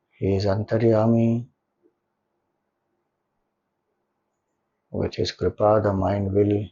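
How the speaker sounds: guided meditation. Acted. Leader settling listeners into a comfortable posture and gratitude, guided meditation. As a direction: guide them gently into stillness, leaving long silences between phrases.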